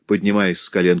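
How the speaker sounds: plain reading voice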